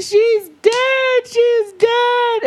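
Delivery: high, wailing cry of grief; retold wail. Teen telling grandma [name] died, wailing high. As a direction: wail it like a grieving kid. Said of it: "I was like".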